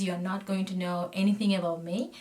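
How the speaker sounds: ordinary talk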